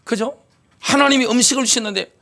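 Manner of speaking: normal talking voice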